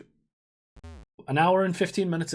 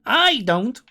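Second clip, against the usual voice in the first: "I" punched high and loud; emphatic insistence; 7 semitones higher, 6 dB louder than usual. Acted. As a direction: lean hard on "I", raised voice. Repeats the insistence.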